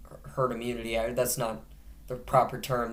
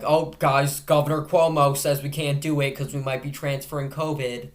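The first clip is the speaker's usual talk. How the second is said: loud, put-on voice, pitched up slightly; mock gangster quote; 2 semitones higher, 5 dB louder than usual. Host voicing gatherers at a shipping dock, loud mock voice. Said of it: "you wouldn't go like".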